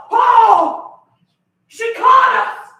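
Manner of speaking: high, loud mock-alarmed shout; caught-out panic. Man caught with someone else, panicked shout. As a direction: cry out as if caught red-handed.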